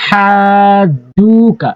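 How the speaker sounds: হাজুকা with the first vowel drawn out very slowly; slow chanted recitation; madd stretch. Shown as a demonstration. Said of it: "এরপর হাজিম জবর হাজ".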